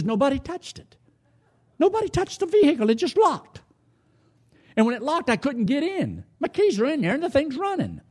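high-pitched, worked-up exasperation; furious retelling. Retold or imitated. Preacher acting out a man furious at his locked truck, high exasperated voice. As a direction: voice jumps up high in mock outrage. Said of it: "I was furious".

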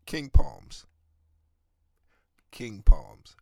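loud hype call-out; loud plug. Real, not acted. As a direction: punch the brand name out loud. Shouting out a product brand.

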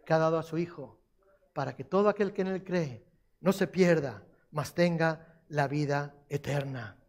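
ordinary talk, nothing marked

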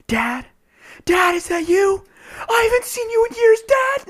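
hammed-up desperate yelling; mock yell. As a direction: build from a call to a frantic shriek. Yelling at stranger from car.

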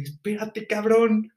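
student pushing back at the sergeant, indignant; exasperated outburst. Retold or imitated. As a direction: blurt it out, fed up. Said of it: "Y yo así como de".

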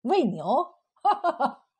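scornful echo, then ha-ha laughter; loud mocking laugh. A narrator's reading. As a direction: laugh his argument off. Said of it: "冯三喜笑得更响亮了".

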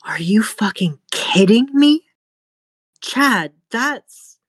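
high, loud, stunned mother; shocked outburst. Acted. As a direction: burst out in disbelief at the wound.

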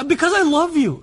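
high, loud mock protest; aggressive declaration. Husband justifying his horrible flower giving, mock-heated.

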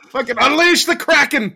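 loud, gleeful cry; excited shout. Acted. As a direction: shout it out with glee. Excited outburst joke.

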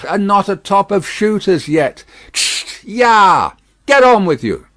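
loud, high urging of horses; exasperated shout building to a holler; vexed coachman. A narrator's reading. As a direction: goad the team impatiently. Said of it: "the vexed coachman".

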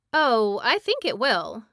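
put-on cheerfully crush the boy's hope; cheerful witch. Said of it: "answered Mombi cheerfully".

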